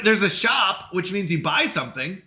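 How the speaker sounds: usual speaking voice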